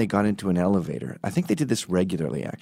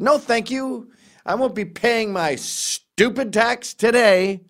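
much higher, slow, prissy put-on voice; mock snooty refusal; 13 semitones higher, 4 dB louder, slower than usual. Retelling snubbing the lottery clerk, mock prissy. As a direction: decline with exaggerated smugness. Comic quotes his own retort.

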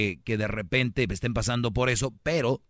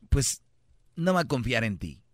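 ordinary delivery

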